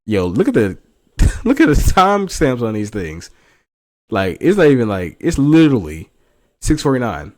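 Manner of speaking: loud, exasperated pointing out; incredulous. Real, not acted. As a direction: hammer the timestamps in disbelief. Reacting to message timestamps.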